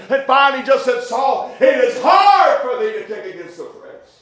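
high, ringing revival preaching; full-force preaching. Acted. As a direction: preach it out with fire.

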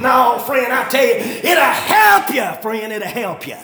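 full-force preaching. Real, not acted. Preacher exhorting congregation about hard work, full-force preaching. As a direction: preach it hard, punching key words.

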